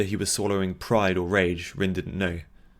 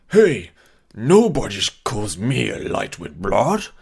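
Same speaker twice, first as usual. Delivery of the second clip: put-on loud slurred drunk; 8 dB louder, slower than usual. Drunk Saldor slurring loudly.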